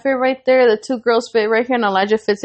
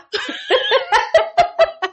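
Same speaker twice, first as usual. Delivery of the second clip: giggle. Real, not acted. Flirty stranger scene, giggling.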